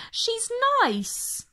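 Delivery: put-on high, light child's voice; little girl voice. Debbie sticking up for Mog, in a high little-girl voice. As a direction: speak up sweetly for the cat like a small girl.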